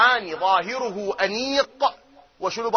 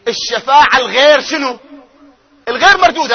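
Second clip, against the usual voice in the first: rousing call; 4 semitones higher, 7 dB louder than usual. Acted.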